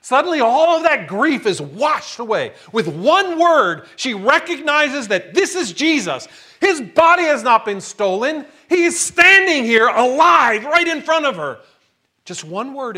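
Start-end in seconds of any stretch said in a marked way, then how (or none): 0.0-11.6 rising joy. Real, not acted. Joyful climax.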